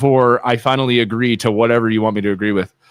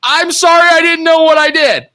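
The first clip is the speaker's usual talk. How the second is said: much higher, louder mock groveling apology; hammed-up pleading husband; 13 semitones higher, 5 dB louder than usual. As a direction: overact the sorry husband begging forgiveness. Voicing himself pleading to wife.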